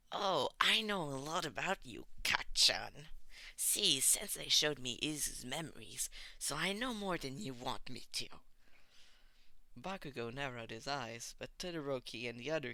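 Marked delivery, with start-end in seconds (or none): 0.1-8.3 louder taunting, smug; wicked grin. A narrator's reading. Tomura taunting Bakugo with a wicked grin. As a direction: gloat and needle him. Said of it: "Tomura grinned wickedly".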